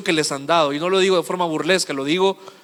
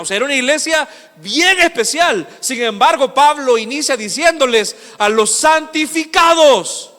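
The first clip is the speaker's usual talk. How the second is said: high-pitched pulpit delivery building to a climax; fervent preaching; 8 semitones higher, 5 dB louder than usual. Real, not acted. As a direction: preach with rising intensity, stretch the final phrase.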